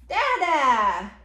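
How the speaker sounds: loud, stretched-out Dada; big singsong exclamation; exclaimed refrain. Acted. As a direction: belt out the baby word playfully. Parent cheers book's refrain to child.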